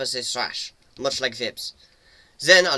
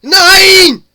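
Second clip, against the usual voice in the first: very high, very loud cry; desperate scream; 19 dB louder than usual. A narrator's reading. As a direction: scream it out in panic. Selma caught by vines.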